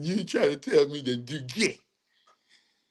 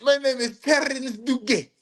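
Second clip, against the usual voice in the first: comic French pronunciation, pitched up; mock French accent; 9 semitones higher than usual. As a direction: say it as a hammed-up Frenchman. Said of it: "it's a French".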